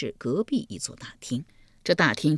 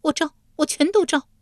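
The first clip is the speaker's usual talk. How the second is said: much higher, rushed in panic; hasty frightened confession; 12 semitones higher, 3 dB louder than usual. Acted. Yulian gives in before the demons, high and panicked. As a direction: blurt out the surrender. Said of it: "玉莲忙道".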